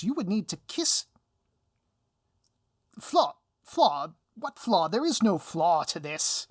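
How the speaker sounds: loud, high, flustered villain denial; a hammed-up sputtering panic. Villain sputters in denial at the flaw in the plan, loud and flustered.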